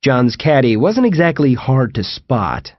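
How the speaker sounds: ordinary delivery, nothing marked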